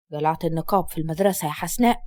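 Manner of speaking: father answering his daughter in a deep voice; stern father. Acted. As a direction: drop low and heavy as the strict father.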